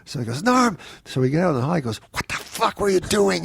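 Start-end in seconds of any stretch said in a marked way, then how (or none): 0.4-0.8 name barked high and a touch louder; sharp call. Retold or imitated. Casting director calls him out. 2.1-3.4 much higher, exasperated demand; angry scolding. Retold or imitated. Casting director scolds in the hall.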